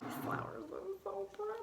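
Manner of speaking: high-pitched little aside of admiration; admiring coo. Real, not acted. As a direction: coo over something pretty. Admiring the flowers, off topic.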